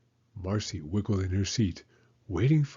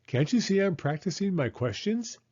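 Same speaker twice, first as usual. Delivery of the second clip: put-on higher, eager little-girl voice; lightly voiced child; 9 semitones higher than usual. A narrator's reading. Marcy answering Mommy, lightly voiced child. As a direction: sound pleased with yourself. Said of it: "she asked".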